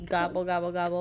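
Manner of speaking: put-on playful turkey noise for a toddler; turkey gobble. Parent imitating turkey for toddler.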